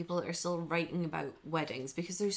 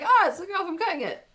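surprised; 11 semitones higher, 7 dB louder than usual. Retold or imitated. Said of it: "And I was like".

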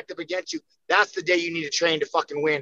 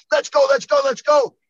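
higher and louder, a burst of impatience; overexcited; 8 semitones higher, 6 dB louder than usual. Retold or imitated. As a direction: rev up like you can't wait another second. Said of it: "I'm too excited".

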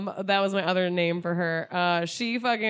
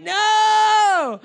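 long, high, loud mock "no"; hammed-up cry of dismay, held out; retold wailing protest; 13 semitones higher, 8 dB louder than usual. Retold dismay at being arrested.

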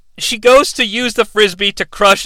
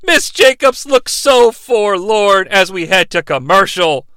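normal talking voice

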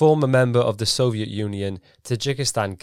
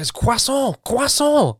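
put-on very high, exasperated French accent; mock French accent; 5 dB louder, slower than usual. French father correcting his daughter, high indignant French accent. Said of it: "in his French accent".

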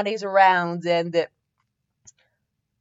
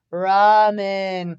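sleep-talking; 4 dB louder than usual. A narrator's reading. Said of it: "Naruto replied in his sleep".

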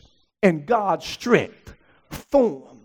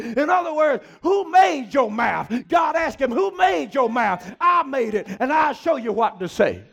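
full-force preaching; 7 semitones higher than usual. Acted. Preacher whipping up the congregation, full-force preaching. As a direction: preach it to the rafters, building the church up.

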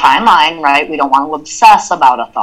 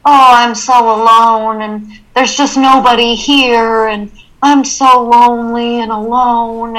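higher and louder, a dramatic self-pitying wail; self-pitying moan; 7 semitones higher, 5 dB louder than usual. Shown as a demonstration. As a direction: whine it like you're so sorry for yourself. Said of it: "that's a very, very different conversation".

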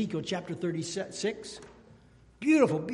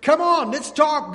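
high, loud inviting call; voicing God; 8 semitones higher, 10 dB louder than usual. God inviting sinners to reason, loud eager call. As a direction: call them in warmly and eagerly. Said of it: "God says".

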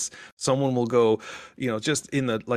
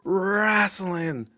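high, drawn-out shout in imitation; retold yell; 9 semitones higher than usual. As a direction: reenact a lone fan's long call. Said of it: "I just yell".

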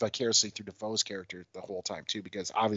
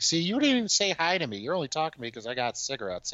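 higher-pitched teasing complaint; mock accusing; 7 semitones higher, faster than usual. Woman calls out man over cigarettes, high mock accusation. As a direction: imitate someone calling out a freeloader, pitched up. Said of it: "Yeah, she's like".

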